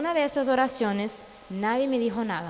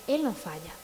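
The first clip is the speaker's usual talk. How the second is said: soft, lower "Él no falla"; 6 semitones lower, 6 dB quieter than usual. Shown as a demonstration. Preacher softly assures parents "Él no falla", lower and quieter. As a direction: drop "Él no falla" lower and softer. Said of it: "repeated with contrast".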